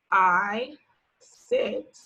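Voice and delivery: very slow, each word set apart; slow word-by-word reading. Shown as a demonstration. Teacher leading kids to read along, slowly. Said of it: "practice saying this sentence and pointing".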